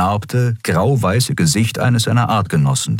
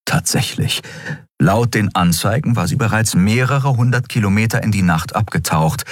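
ordinary delivery, nothing marked

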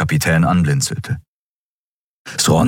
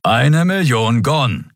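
slow, higher, drawn-out grumpy insistence; growled demand; 7 semitones higher, slower than usual. A narrator's reading. Crewman repeats his demand for pay, growling. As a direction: drag out the sum, grumpily. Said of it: "knurrte Keru".

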